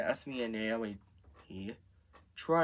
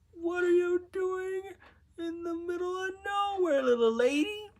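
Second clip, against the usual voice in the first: squeaky-high storybook character; high-pitched character voice. A narrator's reading. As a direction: put on a very high little voice for the question. Character voice for Simba's question.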